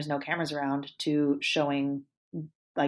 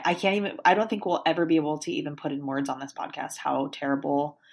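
ordinary talk

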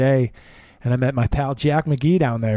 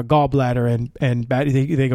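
ordinary delivery

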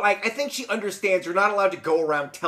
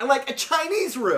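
mock demanding; 7 semitones higher than usual. Podcaster mimicking the Sultan ordering themed rooms, mock demanding. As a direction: reel off a greedy wish list, hammed up.

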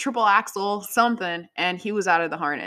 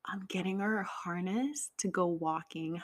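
hushed secret whisper, barely any voice; 9 dB quieter than usual. Real, not acted. Whispering so Selena won't hear about her gift.

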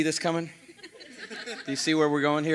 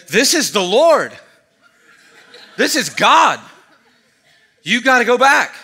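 high, loud, slow proclamation; friend's excited urging; 9 semitones higher, 13 dB louder than usual. Retold or imitated. Friend outside urging him back to Louis, loud excited insistence. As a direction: shout it like a revelation. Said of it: "And they said".

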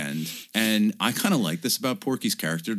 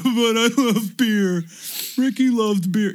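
high, loud, slow goofy voice; beer-loving character; 13 semitones higher, 5 dB louder, slower than usual. Retold or imitated. Character insisting on beer, high slow goofy voice. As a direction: drawl it high and dopey. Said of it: "and he's like".

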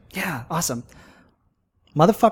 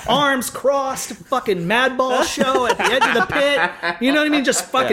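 laughing; 9 semitones higher than usual. Retold or imitated. Describing the stubborn old couple.

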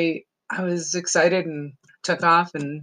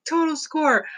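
delighted cheer; 10 semitones higher than usual. Retold or imitated. Cat loves the tower.

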